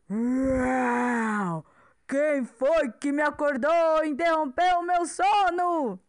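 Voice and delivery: loud booming dinosaur roar; angry T-Rex voice. Acted. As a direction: roar it like a grumpy giant. Woken giant T-Rex speaks.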